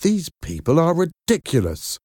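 mildly angry, pitch jumping up. A narrator's reading. Mother fed up with callers, mildly angry. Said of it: "Now, Miranda was angry".

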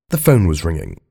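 ordinary delivery

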